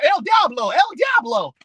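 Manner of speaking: lightly mimicking little kids' cries; mock kids' chant, pitch swooping up; kids parroting El Diablo. Preacher mimics little neighborhood kids, mock kid exclamation. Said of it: "All of them talking about".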